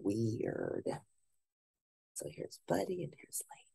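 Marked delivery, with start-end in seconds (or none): 2.1-3.7 put-on hushed aside to the kids; whisper. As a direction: whisper it like a secret. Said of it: "unvoiced whisper".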